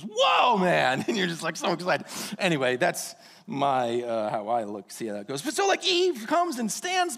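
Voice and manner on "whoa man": Adam naming Eve in awe; amazed whoa. Retold or imitated. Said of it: "It was".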